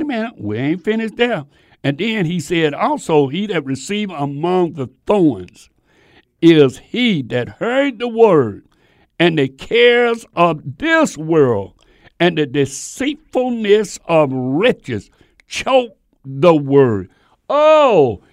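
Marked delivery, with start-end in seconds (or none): 2.7-17.1 louder, slow, preaching cadence; loud, drawn-out scripture delivery; preached scripture reading. Acted. Reads scripture in the sermon. 17.5-18.2 loud, high exclaimed oh; startled exclamation to the congregation; preacher's shout. Acted.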